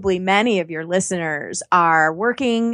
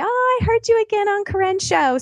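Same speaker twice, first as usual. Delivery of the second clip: imitating a listener, much higher and bright-eyed; gushing fan quote; 13 semitones higher than usual. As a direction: play the delighted fan who recognises her. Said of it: "they always say".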